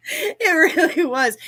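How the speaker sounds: high laughing reply. Real, not acted. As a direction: laugh through the words. Laughing at the piranha joke.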